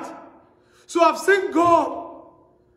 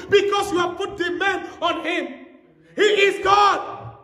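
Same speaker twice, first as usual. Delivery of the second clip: raised, ringing preaching to the crowd; full-force preaching; 5 semitones higher than usual. Acted. Preacher to his congregation, ringing proclamation. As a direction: proclaim it to the whole church.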